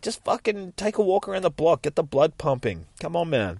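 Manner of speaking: acted pep talk, fed up; exasperated urging. Carer urging Phil to walk, exasperated.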